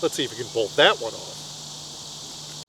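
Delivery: slow, low dry aside; wry aside. Retold or imitated. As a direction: undercut it with a dry murmur. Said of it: "which is a kind of supportive cheer to say".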